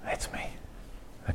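whispered aside. Real, not acted. Preacher whispering aside to the congregation, hushed. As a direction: breathe it out like a secret confession.

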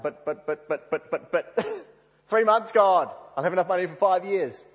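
acted flustered objection, building to an outcry; stammering protest. [name]'s stammered protest to God.

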